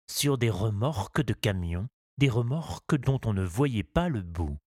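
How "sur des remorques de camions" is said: ordinary delivery, nothing marked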